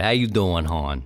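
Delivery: "How you doing, Han?" with Baltimore accent, "Han" low; Baltimore "hon". Shown as a demonstration. Host greeting like a Baltimore local, Baltimore accent. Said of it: "we call everybody Han".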